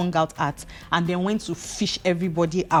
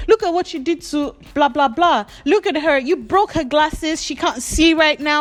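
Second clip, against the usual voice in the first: scolding; 7 semitones higher than usual. Retold or imitated. Scolding Ruth about narrator.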